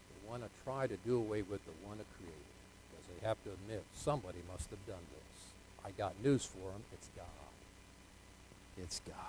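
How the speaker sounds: very soft, low confiding delivery; preacher lowering the voice almost to nothing; hushed preaching. Real, not acted. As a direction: drop right down and let it sink in.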